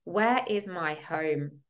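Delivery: ordinary talk, nothing marked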